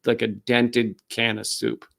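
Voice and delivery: ordinary talk, nothing marked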